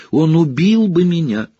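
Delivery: high, frightened whine; terrified whimper. Acted. Pettigrew begs Sirius, high and terrified. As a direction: squeal it out in fear. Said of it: "проскулил Петтигрю".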